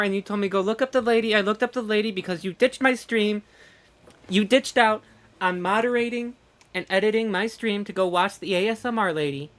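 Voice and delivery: loud, indignant blaming; mock accusing rant. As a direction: lay out the blame with playful outrage. Mock blame of moderator.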